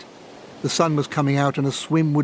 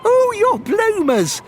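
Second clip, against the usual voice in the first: laughing; 5 dB louder, slower than usual. Acted. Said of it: "laughed Jean".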